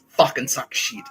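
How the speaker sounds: loud, high laughing jeer; gloating laughter. Retold or imitated. Three friends mocking the jerks' lost ball, laughing gloat. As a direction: crow it through a burst of laughter.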